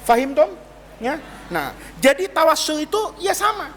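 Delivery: ordinary talk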